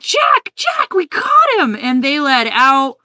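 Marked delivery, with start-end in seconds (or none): 0.0-1.7 much higher, louder gleeful yell; excited henchmen shout. Retold or imitated. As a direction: shout it up high like proud little goons. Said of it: "they're like".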